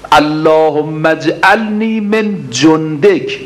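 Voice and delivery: slow, drawn-out Arabic supplication, each word weighed; slow prayer recitation. Acted. Preacher reciting the supplication to the congregation, slow and drawn out. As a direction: intone the prayer slowly like a preacher.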